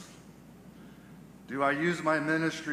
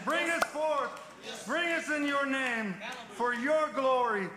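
high, ringing rebuke and praise; fiery preaching prayer; 6 semitones higher than usual. Acted. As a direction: cry out commands and glory to God. Prays at full force.

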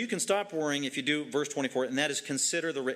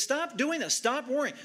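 Jesus, urgent; 10 semitones higher than usual. Acted. Preacher voicing Jesus to his congregation, high urgent plea. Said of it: "Jesus says".

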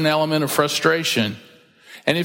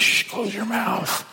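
scolding hush; 6 semitones higher, 4 dB quieter, slower than usual. Acted. Scolding a non-voting complainer.

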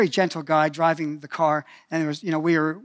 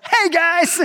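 loud high "Hey, guys" called out; cheerful call out; 11 semitones higher, 5 dB louder than usual. Retold or imitated. Calling out to the soldiers while running across the road.

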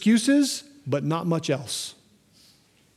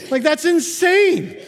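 higher, slow laugh through the words; 10 semitones higher, 5 dB louder, slower than usual. Real, not acted. Pastor laughing at his own knife story, higher and slow. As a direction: laugh it off as absurd.

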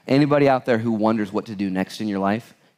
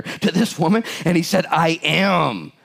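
raised, emphatic Bible reading voice; scripture reading; 7 semitones higher than usual. Acted. Pastor reading Judges to the church, emphatic reading voice. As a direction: proclaim the verses to the congregation.

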